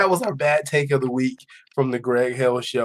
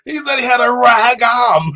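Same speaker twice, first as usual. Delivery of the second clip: laughing shout, cracking up; howling with laughter at the caller; crying laughing; 9 semitones higher, 5 dB louder than usual. Real, not acted. Cohost cracks up retelling the caller, laughing loud shout. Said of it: "I was crying laughing".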